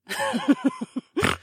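high female laugh; high-pitched outlaw laughter; hearty laugh. A narrator's reading. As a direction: laugh it up at the sky. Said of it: "threw her head back and laughed".

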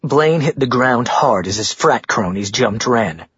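plain reading voice

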